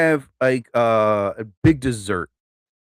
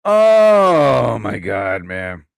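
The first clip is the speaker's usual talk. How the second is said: loud, long stretched-out groan of exclamation; big, slow exclaimed sigh; drawn-out exclamation; 6 semitones lower, 5 dB louder, much slower than usual. Real, not acted. Loud exclamation between topics.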